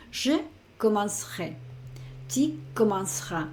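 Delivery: "Je commencerai. Tu commenceras." in French, the middle e nearly silent, "commence" then "rai"; teacher showing French sounds; French pronunciation demo. Shown as a demonstration. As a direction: model the French clearly for a learner. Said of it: "para la pronunciación sería como si dijera commence".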